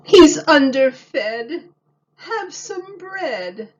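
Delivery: a high, slow fussing lady; lady in red. A narrator's reading. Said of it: "said a lady dressed in red".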